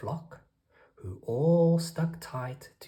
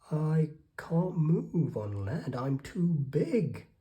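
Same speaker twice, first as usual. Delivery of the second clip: moaning; 5 semitones higher, 5 dB louder, slower than usual. A narrator's reading. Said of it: "moaned the whale".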